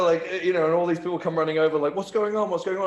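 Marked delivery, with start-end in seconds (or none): 1.9-2.9 alarmed crowd. Retold or imitated. People come running over.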